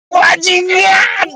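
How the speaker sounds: long, high-pitched scream of a curse; all-out yell. Shown as a demonstration. Showing a friend how to vent somewhere empty, all-out yell. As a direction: yell as hard as you can, drawn out. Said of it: "teriak sekenceng-kencengnya".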